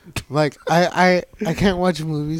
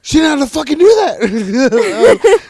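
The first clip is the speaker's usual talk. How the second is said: high, slow and laughing through the words; laughing so hard the line slides up; laughing quote; 11 semitones higher, 7 dB louder, slower than usual. Retold or imitated. Friends retelling a bad day, laughing through it.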